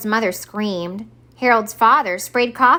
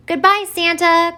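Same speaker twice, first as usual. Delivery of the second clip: higher, louder farewell call; cried out; 9 semitones higher, 6 dB louder than usual. Acted. Harold calling goodbye to Santa, cried out. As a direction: call it out happily. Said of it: "cried Harold".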